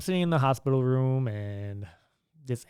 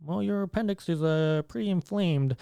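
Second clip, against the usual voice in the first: lightly imitated doctor, pitched higher; subdued hippie doctor; 6 semitones higher than usual. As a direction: break it gently as a deflated doctor. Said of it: "he doesn't have his little pep to his step anymore".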